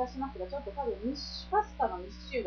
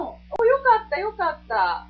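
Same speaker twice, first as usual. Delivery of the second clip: relieved cry; 8 semitones higher, 11 dB louder than usual. Real, not acted. Relieved at the crunchy result.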